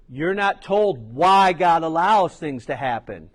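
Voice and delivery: ordinary talk, nothing marked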